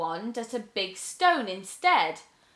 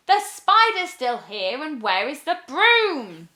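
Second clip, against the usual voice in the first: higher, louder exasperated question; frustrated witch; 6 semitones higher, 7 dB louder than usual. Acted. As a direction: complain in frustration.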